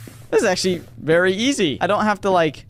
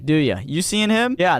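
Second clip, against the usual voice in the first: ordinary delivery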